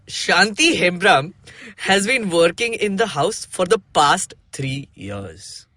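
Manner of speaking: slow storybook narration. Shown as a demonstration. Narrator introducing the maid, slow reading. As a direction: read it out slowly. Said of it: "narrating the textbook story".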